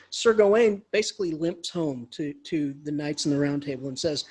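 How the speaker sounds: plain reading voice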